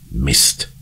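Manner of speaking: usual speaking voice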